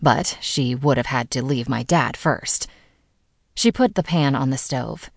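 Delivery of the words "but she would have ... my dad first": ordinary delivery, nothing marked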